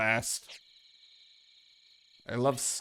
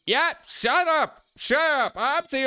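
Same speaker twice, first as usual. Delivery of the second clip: very high-pitched, squawking "shut up"; exasperated outburst; 13 semitones higher, 4 dB louder than usual. Pretend. Player heckling a game character, exasperated squawk. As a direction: shriek it in exasperation, playfully over the top.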